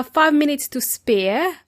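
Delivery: ordinary delivery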